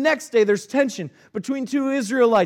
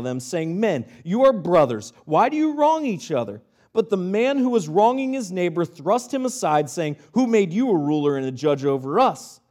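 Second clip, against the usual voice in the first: lower, measured reading of the passage; scripture reading; 5 semitones lower than usual. Acted. Preacher reading scripture to the congregation, measured and lower. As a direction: read the Bible passage steadily to the congregation.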